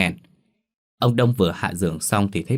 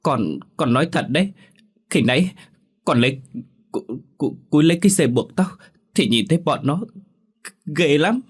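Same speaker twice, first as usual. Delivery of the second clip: higher, stammering through sobs; sobbing, scared; 7 semitones higher than usual. A narrator's reading. Daughter insists to mother she saw ghosts, sobbing. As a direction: gasp it out terrified. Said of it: "nói tiếp trong tiếng nấc".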